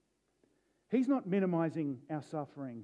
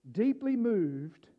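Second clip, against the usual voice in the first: deeply moved drawn out very slow, higher; slow emphasis; 3 semitones higher, 3 dB louder, much slower than usual. Acted. Preacher savouring Jesus' emotion, slow emphasis. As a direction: lean into deeply moved, slowly.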